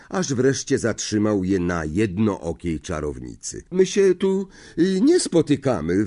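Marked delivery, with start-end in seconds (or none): 3.7-6.0 nervous, high stammer; a frightened boy stammering. A narrator's reading. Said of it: "wyjąkał".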